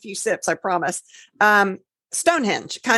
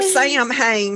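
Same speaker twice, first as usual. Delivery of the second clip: drawn-out Georgia twang, pitched higher; Georgia accent; 6 semitones higher than usual. Shown as a demonstration. Co-host teasing other Georgia people, mock Georgia accent. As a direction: say "Sam Hain" like Georgia folk, stretched and higher. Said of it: "for those other Georgia people".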